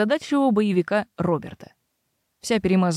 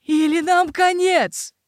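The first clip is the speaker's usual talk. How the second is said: panicked shout; 9 semitones higher, 3 dB louder, slower than usual. Acted. Gregor panics in terror.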